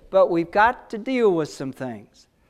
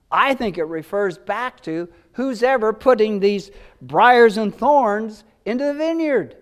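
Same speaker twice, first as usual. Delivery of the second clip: ordinary talk, nothing marked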